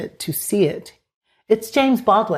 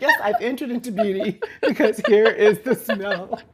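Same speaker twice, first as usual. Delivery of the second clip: rising into a high laughing shout; mock bliss breaking into laughter; laughing mock rapture; 6 semitones higher than usual. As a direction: gush then crack up at the punchline. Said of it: "You're like".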